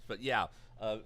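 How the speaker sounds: ordinary delivery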